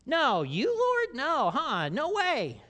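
high, loud incredulous cry; astonished disbelief. Pretend. The righteous answering the king, high and astonished. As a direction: gasp out total disbelief. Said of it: "They're like".

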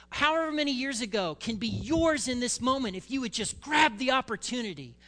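high, loud frantic screaming urge. Acted. Inner voices screaming at David to kill Saul, frantic yell. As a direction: yell it like the whole cave is pushing him to strike. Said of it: "Everything in that cave is screaming".

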